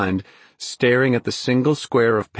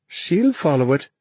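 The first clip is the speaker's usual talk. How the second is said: put-on higher-pitched confident girl; girl's confident reply in a raised voice; 6 semitones higher than usual. A narrator's reading. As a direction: say it with assurance, pitched up. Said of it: "the girl said confidently".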